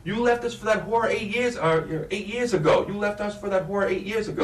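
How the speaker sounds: high, loud accusing scream; angry screaming. Shown as a demonstration. Director acting the furious wife, screaming high. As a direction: hurl it at the husband who walked out. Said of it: "you're gonna be screaming".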